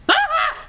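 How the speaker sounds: short, very high scream; startled shriek. Real, not acted. Player startled by Grandpa, short high shriek. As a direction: burst out in a piercing yelp.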